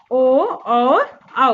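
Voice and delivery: ओ and औ stretched out very slowly. Shown as a demonstration. Teacher slowly dictates ओ and औ to write down. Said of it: "देखिए अब यहाँ पास आप लिखिए".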